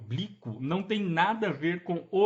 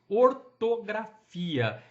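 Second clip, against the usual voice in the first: ortografia drawn out very slowly and louder; slow emphasis; 2 semitones higher, 3 dB louder, much slower than usual. Shown as a demonstration. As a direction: lean on ortografia, slowly, so it sticks. Teacher spells out the key term.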